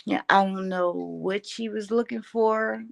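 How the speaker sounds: ordinary talk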